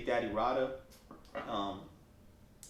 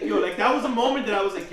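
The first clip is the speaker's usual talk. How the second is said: ordinary talk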